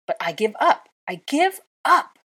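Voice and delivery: high, loud despairing cry; giving up. A narrator's reading. Hermione shouting at Draco that she gives up. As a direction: throw your hands up and shout it. Hermione's furious outburst continues.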